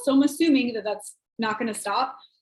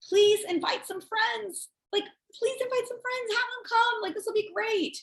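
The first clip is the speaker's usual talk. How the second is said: high, light, sing-out request; friendly coaxing; 10 semitones higher, 3 dB quieter than usual. Acted. Host asking a guest to invite friends, lightly coaxing. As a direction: coax sweetly in a raised, lighter voice. Models friendly follow-up text.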